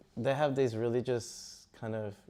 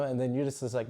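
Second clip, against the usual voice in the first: ordinary delivery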